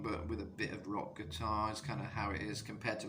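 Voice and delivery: ordinary talk, nothing marked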